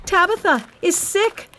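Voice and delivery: urgent high shout. A narrator's reading. Anxious widow to all the poor widows, shouted alarm. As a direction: shout the news to the whole street. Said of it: "she shouted to all".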